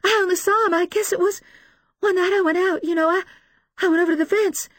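high, loud, halting confession; distraught mother recounting; sobbing. A narrator's reading. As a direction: stammer it out through sobs. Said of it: "Missus Paulson sobs".

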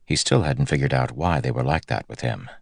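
plain reading voice

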